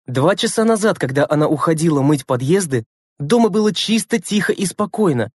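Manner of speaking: ordinary delivery, nothing marked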